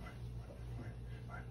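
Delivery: whispered soothing; whispering. Real, not acted. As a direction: whisper it gently. Soothing bird, unvoiced.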